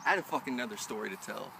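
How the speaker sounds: ordinary delivery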